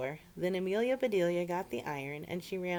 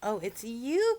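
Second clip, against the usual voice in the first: delighted greeting; 6 semitones higher, 6 dB louder, slower than usual. Acted. Amelia greets cousin.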